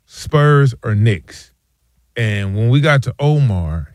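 normal talking voice

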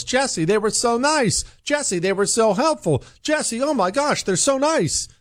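high, gushing imitation of a delighted caller; gushing customer. Imitating a listener gushing to Jesse, high and gushing. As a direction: play a customer raving about how nice they were. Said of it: "somebody raving about how nice customer service was".

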